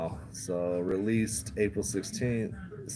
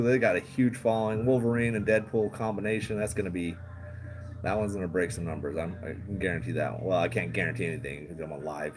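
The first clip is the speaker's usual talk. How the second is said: normal talking voice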